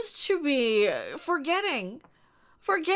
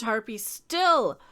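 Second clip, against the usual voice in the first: normal talking voice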